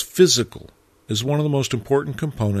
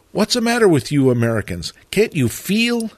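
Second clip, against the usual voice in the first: rising exasperated shout, pitch leaps high; exasperated outburst; 7 semitones higher than usual. Retold or imitated. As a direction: burst out at the students in frustration. Teacher slams desk, stands up.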